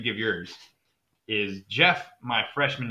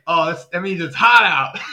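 higher, loud shouted explanation; mock explaining shout; 6 semitones higher, 10 dB louder than usual. Jeff explaining his slang, mock shouted explanation. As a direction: blurt the meaning out loud. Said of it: "and he's like".

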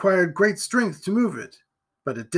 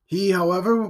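ordinary delivery

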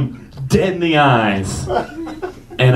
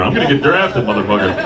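retold boast; 4 semitones lower, faster than usual. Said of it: "getting shit talked by David Alan Grier".

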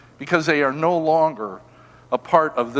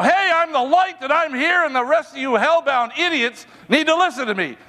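arrogant preacher; 11 semitones higher than usual. Retold or imitated. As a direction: lord it over the room, high and cocky. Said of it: "you don't walk into those places and go".

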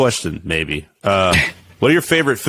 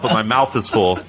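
ordinary delivery

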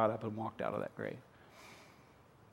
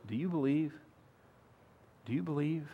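softened question; 5 dB quieter than usual. Acted. Preacher's soft repeated question.

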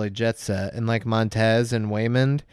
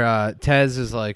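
ordinary talk

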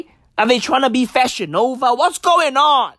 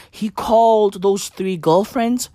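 ordinary delivery

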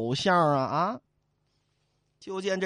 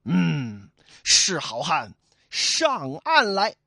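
gruff challenge; 5 dB louder, much slower than usual. Acted. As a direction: order him ashore. Li Kui challenges the boatman. (李逵低沉地叫阵)

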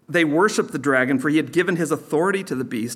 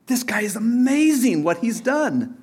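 admirers' voice; 8 semitones higher than usual. Acted. Preacher voicing the beast's admirers.

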